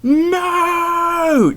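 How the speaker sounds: high, loud, drawn-out scream. Acted. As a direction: wail it like a movie character in despair. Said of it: "and I screamed".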